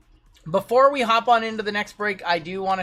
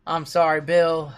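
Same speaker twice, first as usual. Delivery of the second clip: normal talking voice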